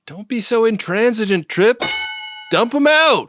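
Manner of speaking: very high mock lady voice; high teasing voice. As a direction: squeak it out playfully. Mock T'Pol teasing Trip.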